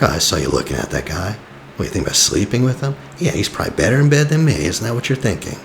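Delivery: imitating a jealous man lashing out; jealous accusation. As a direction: rattle off a suspicious accusation, voice climbing. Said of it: "say very ugly things when they're feeling insecure".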